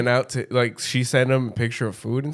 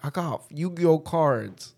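slow, higher stoned-friend imitation; Rob; 4 semitones higher, slower than usual. Stoned friend announcing his cards, slow mocking voice. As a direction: stretch it out as a mock stoned brag. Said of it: "And Rob's like".

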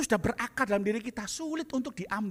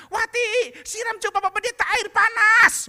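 high, loud shout across the yard; yelling to maid; 10 dB louder than usual. Retold or imitated. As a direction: yell for the maid. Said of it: "Dia teriak pembantunya". (ibu berteriak memanggil pembantunya dengan keras)

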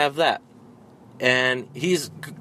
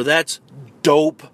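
mock slang; 5 dB louder than usual. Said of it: "where they're like".

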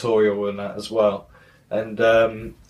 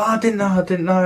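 higher, pleading mock apology; really apologetic; 7 semitones higher than usual. Retelling man apologising to the boss, mock apologetic pleading. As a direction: imitate someone flustered and backing down. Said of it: "he's, like, really apologetic".

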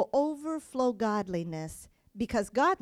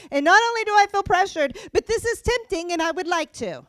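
higher and louder, pressing harder; rising preaching shout; 6 semitones higher, 7 dB louder than usual. Real, not acted. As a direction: build the pressure into a cry.

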